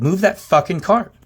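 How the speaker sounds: high, slow, forceful retold command; retold angry order. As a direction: act out ordering someone out of the way. Retold angry order to cart driver.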